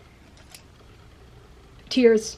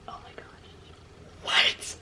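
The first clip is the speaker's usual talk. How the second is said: stunned whisper; 13 dB quieter than usual. Retold or imitated. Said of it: "being like".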